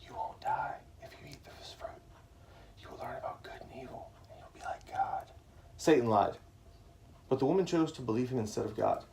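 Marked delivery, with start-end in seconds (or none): 0.1-5.3 voiceless snake whisper to Eve. A narrator's reading. Satan as a snake whispering to Eve, tempting. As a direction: hiss it softly like a tempting serpent. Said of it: "whispered to Eve".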